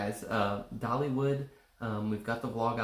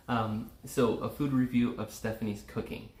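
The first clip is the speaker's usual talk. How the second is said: normal talking voice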